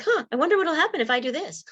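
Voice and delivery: put-on high, quick little-kid voice, wondering aloud; curious child. Speaker voicing her childhood self, high and quick. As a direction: play a curious kid who's just wondering what happens. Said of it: "The stuff I did was like".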